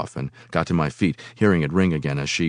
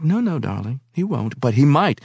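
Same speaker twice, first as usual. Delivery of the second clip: teasing woman; 7 semitones higher than usual. A narrator's reading.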